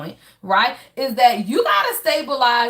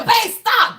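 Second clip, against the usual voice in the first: retold yell; 9 semitones higher, 5 dB louder than usual. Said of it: "He said".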